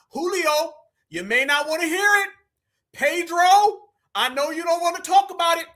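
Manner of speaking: single them out, needling; calling them out. Real, not acted.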